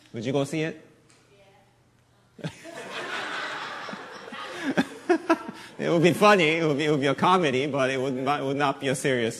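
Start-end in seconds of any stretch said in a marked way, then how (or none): 2.5-5.7 airy, high-pitched burst of laughter; breathy high laugh. Real, not acted.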